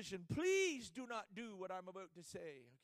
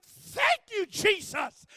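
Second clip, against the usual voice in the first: much higher, loud cry of thanks; joyful shout; 10 semitones higher, 17 dB louder, much slower than usual. Retold or imitated. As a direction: burst out in thanks. Excited thanks to Jesus.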